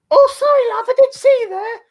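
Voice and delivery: very high, loud falsetto mimicry; mock apologetic dawdler. Mimics the dawdling old people.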